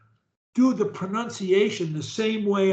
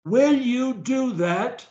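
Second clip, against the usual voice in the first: careful pronunciation; 3 semitones higher than usual. Shown as a demonstration. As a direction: draw out every word with full articulation. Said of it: "pronunciation of every word".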